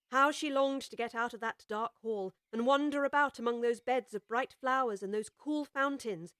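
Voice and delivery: plain reading voice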